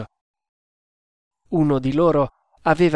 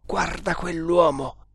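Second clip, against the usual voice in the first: tense exclamation held back behind the teeth; restrained, tight-jawed outburst; through gritted teeth; 3 semitones higher than usual. A narrator's reading. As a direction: force it out with teeth clenched. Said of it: "esclamò Tremal Naik coi denti stretti".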